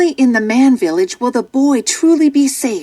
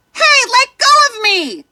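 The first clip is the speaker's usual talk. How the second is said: put-on high boy's voice crying out; a child's panicked high shout; 13 semitones higher than usual. A narrator's reading. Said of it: "Mowgli shouts".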